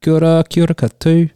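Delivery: put-on loud, fast Kiwi accent. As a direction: greet in a hammed Kiwi accent. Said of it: "Kiwi accent that I put on".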